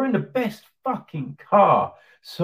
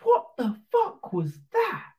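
high incredulous cry of disbelief; outraged rival team; 12 semitones higher than usual. Retold or imitated. Other team crying out in outrage at the stewards. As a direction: rival team boss shrieking at the decision. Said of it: "The other teams have gone".

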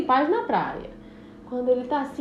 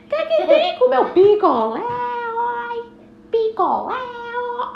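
loud high sung cry; singsong beach vendor call; 12 semitones higher, 6 dB louder, slower than usual. Acted. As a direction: push the sale. Vendor insisting.

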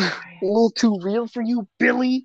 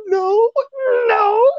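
high, louder squeaky no's with a cry; retold crying kid; 4 dB louder than usual. Friend retelling the game kid's tearful denial, high and squeaky. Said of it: "He's like".

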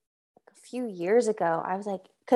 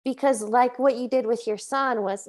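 ordinary talk, nothing marked

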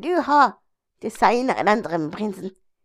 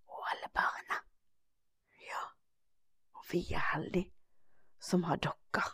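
soft whisper, winding down for sleep; 4 semitones lower, 14 dB quieter than usual. Acted. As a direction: hush it as if children are falling asleep. Bedtime whispering in the hut.

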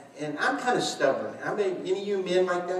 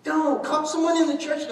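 wife's warning; 9 semitones higher than usual. Retold or imitated. Wife warns her recovering husband not to get the cat. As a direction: warn him off, lightly mimicking wife. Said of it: "She goes".

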